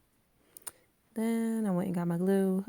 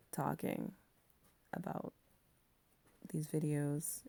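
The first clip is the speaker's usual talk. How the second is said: sleepy, heavy late-night voice; groggy voice; 2 semitones lower, 5 dB quieter than usual. Real, not acted. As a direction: talk like you're half asleep in bed. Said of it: "groggy voice".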